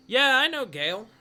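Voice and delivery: high, loud eager local; eager townsfolk. Acted. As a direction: blurt it out eagerly. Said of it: "a couple people who are like".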